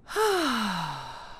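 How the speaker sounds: long audible exhaled sigh; dramatic sigh. Shown as a demonstration. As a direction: breathe out with a big sigh. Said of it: "Out with a sigh".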